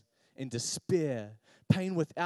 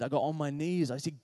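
usual speaking voice

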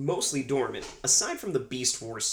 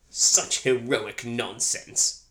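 sneering villain voice; 5 dB louder, much slower than usual. Retold or imitated. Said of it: "When we quote this movie".

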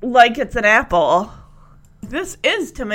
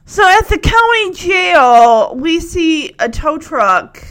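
loud, slow, rising excitement; excited; 5 semitones higher, 7 dB louder, slower than usual. Real, not acted. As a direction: call it out, thrilled. Excited announcing.

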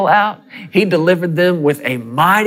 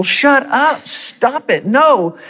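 retold yelling; 10 semitones higher, much slower than usual. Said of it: "yelling at these people".

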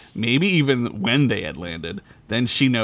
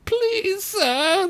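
put-on very high old elf begging; old elf begs the knight; groveling elf. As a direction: grovel desperately. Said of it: "the elf groveled".

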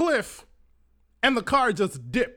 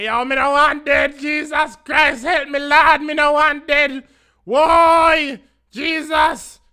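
panicked screaming; 5 semitones higher, 7 dB louder than usual. Retold or imitated. Passenger re-enacting pleas to Jesus as the car tumbles, screaming in panic. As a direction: scream for help to Jesus in Jamaican Patois, then trail away. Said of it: "I started screaming. I'm screaming my ass off".

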